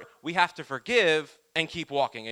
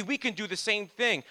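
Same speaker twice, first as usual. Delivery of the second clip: ordinary delivery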